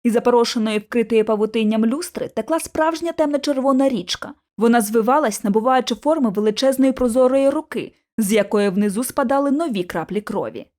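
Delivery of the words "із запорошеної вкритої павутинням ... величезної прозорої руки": ordinary delivery, nothing marked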